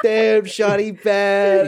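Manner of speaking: hammed-up North Van kid, loud, high and drawn out. Friend mimicking kids from North Van talking about girls, hammed-up slang. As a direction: stretch it out slow and high, mocking the local kids. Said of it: "like, just kids from North Van say".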